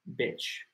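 "Beach" cut to a short i, like bitch, said softly; short vowel. Shown as a demonstration. Teacher showing the rude other word, soft short-vowel "Beach". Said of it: "la otra palabra es más corta".

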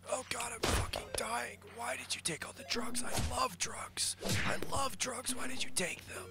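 high breathless whispered dying gasps. Acted. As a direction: fade out like a dying man. Dying character's last words.

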